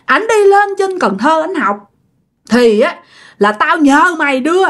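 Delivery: high-pitched, wailing demands to know why; acted jilted spirit's tirade; scolding accusation. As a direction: berate a friend who betrayed you, pitch climbing sharply. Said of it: "Vừa liếc vừa chửi vừa chỉ nha".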